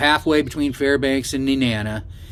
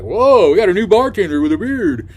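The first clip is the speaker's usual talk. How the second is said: louder, higher mock-amazed codgers; imitated old codgers; 5 semitones higher, 5 dB louder than usual. Old codgers greet the new bartender, imitated amazed hoot. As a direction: hoot like impressed barflies. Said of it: "a bunch of old codger guys".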